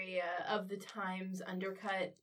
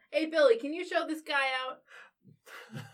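louder, slow call to a coworker; calling across the room; 5 semitones higher, 6 dB louder, slower than usual. Acted. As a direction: call Billy over, unhurried. Official calls to colleague.

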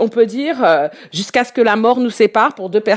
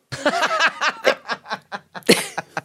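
laughing; 3 semitones higher, 6 dB quieter than usual. Real, not acted. Admitting they never heard the song, laughing. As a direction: burst into a long, soft laugh. Amused at not knowing the original.

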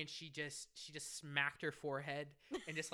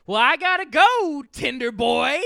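much higher and louder sing-song tease; teasing call-out; 12 semitones higher, 25 dB louder than usual. Retold or imitated. Manager teasing the server about his Tinder match, loud. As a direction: call it out gleefully as you leave.

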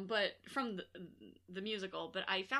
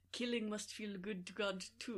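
bad Mads Mikkelsen accent; 4 dB quieter than usual. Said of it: "That's so bad".

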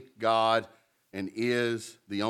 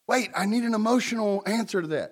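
mock whiny objector; 8 semitones higher than usual. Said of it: "mocking an objector".

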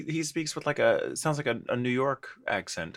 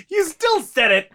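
high, loud, drawn-out accusation; laughing shout; 8 dB louder, slower than usual. Real, not acted. Co-host catches the host out, high laughing shout. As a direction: squeal it slowly through laughter.

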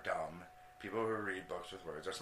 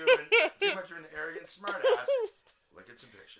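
normal talking voice

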